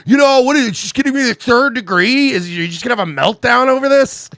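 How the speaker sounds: hysterical mock girlfriend. Cheating girlfriend screams back when questioned, loud high mock screech.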